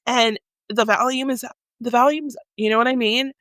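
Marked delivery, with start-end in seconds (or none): none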